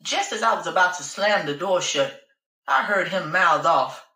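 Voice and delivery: usual speaking voice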